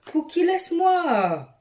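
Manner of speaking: telling Cookie to leave her alone; calling the cat. Real, not acted. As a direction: shoo the cat away, drawn out. Scolding the cat nearby.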